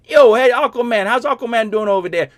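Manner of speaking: loud, high New York accent mocking a lazy fan question; brash New York accent, playing a bored fan; New Yorker. Streamer mocks a shallow fan question in loud New York accent.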